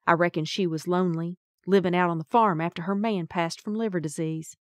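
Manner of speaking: ordinary delivery, nothing marked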